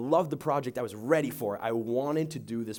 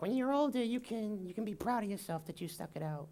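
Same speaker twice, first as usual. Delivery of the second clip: much higher sing-song parent imitation; mock parent voice; 8 semitones higher, 7 dB quieter than usual. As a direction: mimic a preachy parent, pitched way up. Parent's voice mimicked in sermon.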